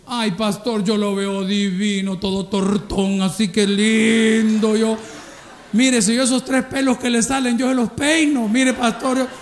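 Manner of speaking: high, loud, slow swooning praise; gushing church sister. Pretend. A smitten sister gushing to the pastor about her husband. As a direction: gush over a homely husband like a smitten wife. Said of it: "No sé cómo usted puede amar a ese monstruo".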